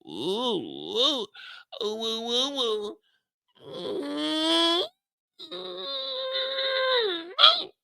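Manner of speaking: high, rising "ooh" squeals; mock lustful oohing. Pops eyeing him like steak, mock lustful falsetto oohs. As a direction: climb into a long falsetto coo. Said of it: "Pops is looking him up and down like a piece of steak".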